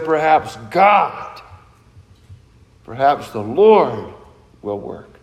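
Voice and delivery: humble trust, sinking to a hush on "will work"; "Lord" said low and slow, "will work" near silent; stress on God. Retold or imitated. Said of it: "but rather he says".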